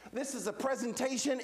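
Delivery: normal talking voice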